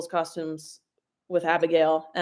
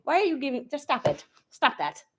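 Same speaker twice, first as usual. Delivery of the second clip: normal talking voice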